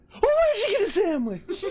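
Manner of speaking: high, excited, gleeful squeal; gleeful high quote. Retold or imitated. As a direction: mimic him bursting in with glee. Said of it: "talking like a normal human being before that".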